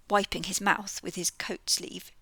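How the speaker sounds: ordinary delivery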